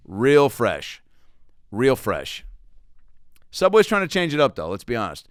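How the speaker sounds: ordinary delivery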